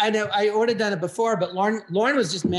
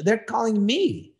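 normal talking voice

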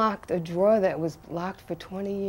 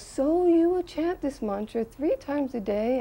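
slow, high, rising question; Prabhupada; 7 semitones higher than usual. Retold or imitated. Guru repeating the instruction to a nervous disciple, slow high imitation. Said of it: "And he goes".

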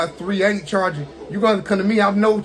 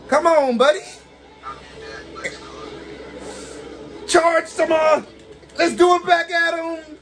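rally your guy mid-fight; excited gamer shout at the screen; hyped cheering; 9 semitones higher, slower than usual. Real, not acted. Hyping his character during a fight.

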